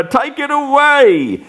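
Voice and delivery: high pleading echo; desperate repeat. Acted. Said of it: "Next he cries".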